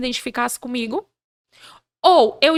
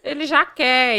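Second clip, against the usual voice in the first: usual speaking voice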